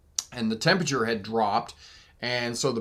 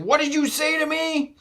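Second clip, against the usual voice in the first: much higher, lightly mocked angry challenge; angry driver. Pickup driver backing up to confront, mock indignation. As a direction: mimic a hot-headed driver demanding answers. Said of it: "He's like".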